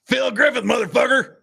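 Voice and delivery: high, loud, hammed-up respondent; brash character voice. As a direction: blurt your name defiantly, way up in pitch. Acting the brash respondent in court.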